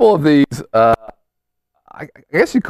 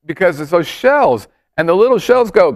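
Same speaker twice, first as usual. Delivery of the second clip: normal talking voice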